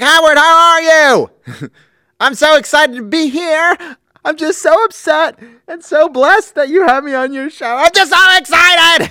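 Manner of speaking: bombastic, high-pitched shrieking gush; Richard Simmons impression. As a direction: swing between tearful gratitude and screamed excitement. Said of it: "He goes from bombastic to crying".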